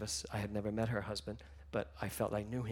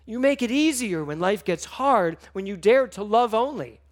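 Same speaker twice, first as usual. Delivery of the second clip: higher and louder, pressing the words; passionate, louder declaration; loud impassioned plea; 8 semitones higher, 10 dB louder than usual. Real, not acted. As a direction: preach it to yourself with force. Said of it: "I'd like to say that to myself".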